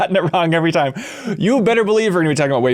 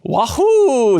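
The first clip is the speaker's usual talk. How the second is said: Charles Martinet voice; 10 semitones higher, 3 dB louder than usual. Retold or imitated. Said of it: "The new Charles Martinet".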